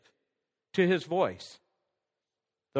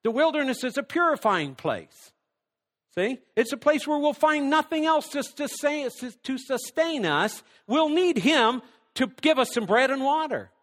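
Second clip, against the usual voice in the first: raised, fervent preacher's delivery; preaching; 7 semitones higher than usual. Acted. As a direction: preach it out to the congregation. Opening with preaching force.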